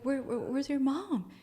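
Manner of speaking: uneasy re-enacted asking; flustered questioning. Flustered question to child spirit.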